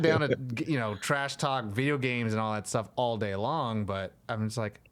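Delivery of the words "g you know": ordinary delivery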